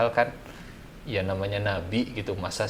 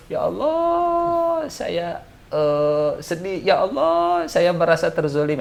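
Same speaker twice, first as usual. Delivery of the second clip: much higher, slow, drawn-out plea to God; mock lament; 8 semitones higher, 5 dB louder, slower than usual. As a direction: wail your hurt to God in a hammed-up way. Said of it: "Tapi kalau". (ratapan kepada Allah yang dibuat-buat, tinggi dan lambat)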